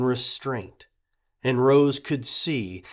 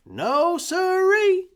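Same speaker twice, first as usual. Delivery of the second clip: put-on much higher, slow sing-song child voice; eager boy; 7 dB louder, slower than usual. A narrator's reading. Young boy echoing Red, high and eager. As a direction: echo the old man brightly like a kid.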